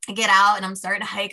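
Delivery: ordinary talk, nothing marked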